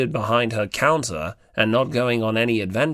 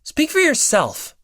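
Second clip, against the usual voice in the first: much higher, sharp, annoyed snap; spat-out retort; 13 semitones higher than usual. Acted. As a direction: spit the words back at him, stung. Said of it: "Hinata spit out, even more angry".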